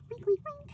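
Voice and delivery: high playful oinking; pig oinks. Pretend. Counting pig stickers, she oinks high and playfully. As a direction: oink like a little pig. Imitating pigs after "lot of oinks.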